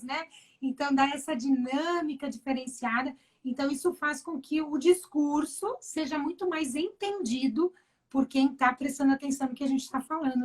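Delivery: usual speaking voice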